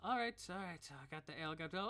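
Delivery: a hint of a bustling serving girl; barmaid voice. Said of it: "She says".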